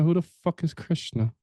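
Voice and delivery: ordinary talk, nothing marked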